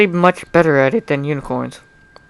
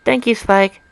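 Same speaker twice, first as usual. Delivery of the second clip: higher Twilight voice; 7 semitones higher than usual. Acted. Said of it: "Twilight thanks Spike".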